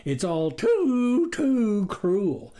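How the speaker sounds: slow, hammed-up sorrow; milk the cruelty; mock lament. A narrator's reading. The wolf laying it on thick for the farmers.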